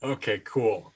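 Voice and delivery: ordinary talk, nothing marked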